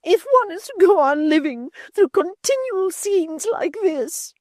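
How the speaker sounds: put-on high whining old mother. A narrator's reading. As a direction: whine it self-pityingly. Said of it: "she whined".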